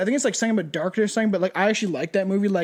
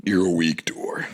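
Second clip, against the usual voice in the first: mock deep voice. Mock retort to friend.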